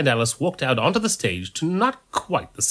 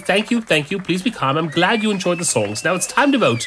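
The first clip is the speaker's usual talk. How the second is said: high announcer voice, rising to loud; stage host; 10 semitones higher, 5 dB louder than usual. Acted. Priest asks crowd to choose a winner, stage host. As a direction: work the crowd towards the vote.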